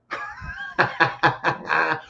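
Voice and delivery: soft, drawn-out chuckle. Real, not acted. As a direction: laugh quietly to yourself at your own joke. Laughs at his own wording.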